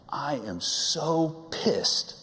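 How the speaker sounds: high, drawn-out furious quote of mom; mock fury, high and very slow; mock angry mom. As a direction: play the fuming wife, stretched slow. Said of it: "and she said".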